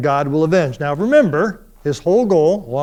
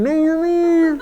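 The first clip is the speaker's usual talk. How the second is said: inner protest; 11 semitones higher than usual. Retold or imitated. I'm thinking.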